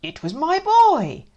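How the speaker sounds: put-on high loud dad blaming his boy. Dad blaming his son, loud and high. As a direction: point the finger at the boy.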